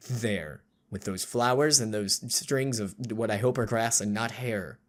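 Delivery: low, hushed narrator reading; story narration. A narrator's reading. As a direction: set the eerie scene softly. Reads game narration.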